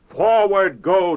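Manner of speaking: high, loud ringing call of Forward; preacher's climactic cry of Forward; rising proclamation. Acted. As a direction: proclaim Forward like a battle order.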